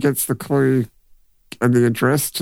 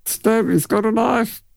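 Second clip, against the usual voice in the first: movie character quote; 11 semitones higher, slower than usual. Retold or imitated. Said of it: "the way he says that".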